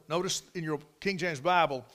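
ordinary delivery

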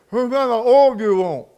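words mushed around a wad of tobacco; mouth full of tobacco. Retold or imitated. Mechanic in the pit calls up, mumbling through tobacco. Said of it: "he had a big chaw of tobacco in his mouth".

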